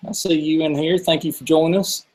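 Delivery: usual speaking voice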